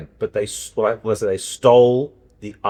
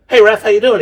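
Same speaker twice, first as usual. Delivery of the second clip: high, loud and bright hey to his son; a high, loud hello down the line; cheery phone greeting; 11 semitones higher, 8 dB louder than usual. Retold or imitated. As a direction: call out like a cheerful dad on the phone. Father calling son on phone.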